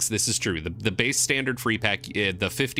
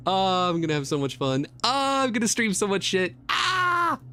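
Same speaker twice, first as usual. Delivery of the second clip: rising higher to an excited shout; building excitement; 8 semitones higher than usual. Real, not acted. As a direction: let the excitement boil over.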